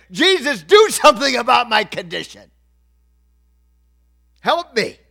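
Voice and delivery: loud, high imitated appeal; retold urgent plea. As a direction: voice a beggar demanding help. Acting out blind beggar's demand.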